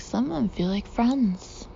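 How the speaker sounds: ordinary delivery, nothing marked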